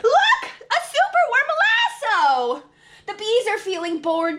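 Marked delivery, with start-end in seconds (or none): 0.0-2.6 excited. Acted. Excited discovery in story.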